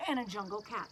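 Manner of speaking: usual speaking voice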